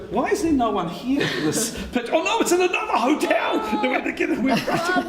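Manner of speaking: high-pitched, laughing mock panic; hammed-up alarm through laughter; comic panic. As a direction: retell it in a flustered, rising squeal. Retold panic at wrong venue.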